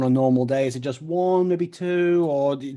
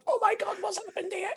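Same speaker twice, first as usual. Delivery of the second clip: much higher, strained croak of a ruined throat, fading off; mock wrecked voice; 5 dB quieter than usual. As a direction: play-act waking up with a shot voice. Mocking a wrecked morning voice.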